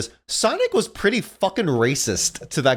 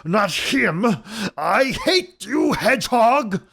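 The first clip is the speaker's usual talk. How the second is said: high, drawn-out cartoon villain wail; raging Robotnik; 9 semitones higher, much slower than usual. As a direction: wail it slowly with hammed-up hatred. Robotnik rages at Sonic, acted.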